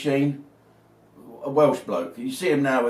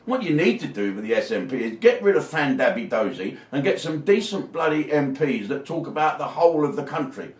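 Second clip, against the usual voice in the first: normal talking voice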